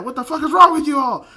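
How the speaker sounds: loud, high mock-outraged yell; exasperated scolding. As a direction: blow up at the whole group, acting it out. Acted scolding of the group.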